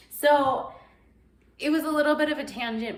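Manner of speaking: ordinary talk, nothing marked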